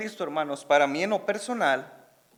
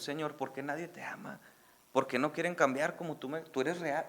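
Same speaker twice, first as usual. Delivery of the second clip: quiet tearful prayer; 9 dB quieter than usual. Retold or imitated. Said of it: "a llorar como niño, en silencio".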